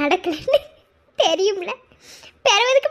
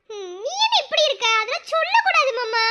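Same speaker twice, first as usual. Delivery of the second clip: giggling protest; 8 semitones higher than usual. Acted. Bride embarrassed, giggling.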